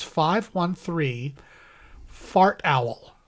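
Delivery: slow, deliberate number read; slow spelled-out number. Shown as a demonstration. Host slowly giving listeners the phone number. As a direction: say each digit slowly so it sticks. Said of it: "there's your mnemonic".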